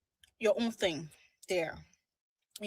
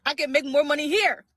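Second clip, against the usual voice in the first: louder, firmer repeat; emphatic; 4 semitones higher, 10 dB louder than usual. Shown as a demonstration. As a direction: say it loud and proud. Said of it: "laugh, repeated louder".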